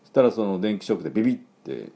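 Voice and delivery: normal talking voice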